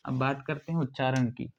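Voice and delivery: usual speaking voice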